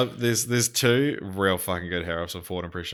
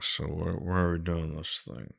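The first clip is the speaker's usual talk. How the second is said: mock Harrison Ford mumble; 3 semitones lower, 9 dB quieter than usual. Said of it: "And then he's just like".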